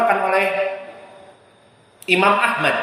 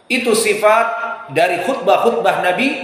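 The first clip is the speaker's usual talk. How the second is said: ordinary talk, nothing marked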